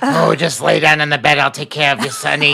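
leering old lecher, lightly voiced; a hint of a creepy old man; dirty old man voice. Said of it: "dirty old man voice playing in my head".

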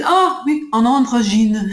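put-on lifted, admiring take on a French accent. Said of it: "in this very, like, beautiful French accent".

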